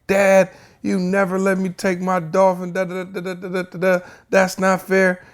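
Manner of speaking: put-on high whining child voice, complaining; whiny kid. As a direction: whine like a sulking boy. Angry son whining, quoted.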